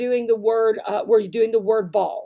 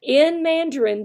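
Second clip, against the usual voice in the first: normal talking voice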